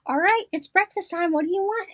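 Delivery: lightly voiced sing-song caregiver to a stubborn mom; talking to a kid. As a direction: coax her like a small child at breakfast. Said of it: "I have to do her like the kids".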